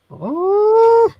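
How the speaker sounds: high, loud excited squeal. Shown as a demonstration. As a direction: let out a piercing, excited ooh. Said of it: "when I'm excited".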